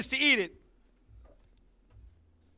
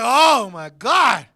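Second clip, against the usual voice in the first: amazed; 8 dB louder, much slower than usual. Retold or imitated. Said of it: "So I'm like".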